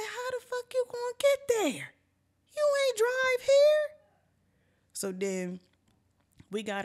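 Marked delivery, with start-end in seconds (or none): 0.0-4.0 incredulous retort. Retold or imitated. Storyteller to friend wanting to go home, incredulous. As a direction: climb high and louder on the comeback.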